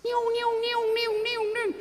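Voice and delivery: high nasal-free wailing siren sound; siren imitation. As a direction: mimic a police siren, high and steady. Imitating police siren escort.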